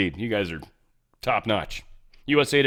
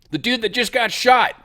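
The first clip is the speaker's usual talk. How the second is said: usual speaking voice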